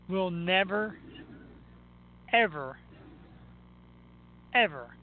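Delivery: NEVER, EVER, EVER, each set apart by a long pause; emphatic pauses. Real, not acted. Emphatic rebuttal to radio callers.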